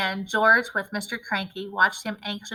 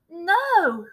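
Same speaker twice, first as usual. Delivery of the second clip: higher, louder alarmed no; cried out; 8 semitones higher, 5 dB louder than usual. A narrator's reading. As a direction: stop her in alarm. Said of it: "cried Miss Cranky".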